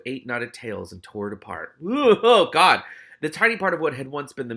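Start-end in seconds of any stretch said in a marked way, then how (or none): none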